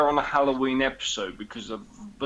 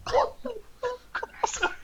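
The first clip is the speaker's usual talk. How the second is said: shocked high cry. Retold or imitated. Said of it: "And we were like".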